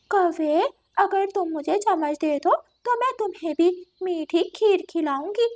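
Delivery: lightly voiced tempting cat; a touch of sly coaxing, as a cat. Cat offers crow sweet kheer, lightly coaxing.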